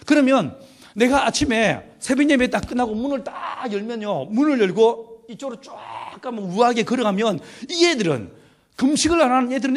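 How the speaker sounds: ordinary talk, nothing marked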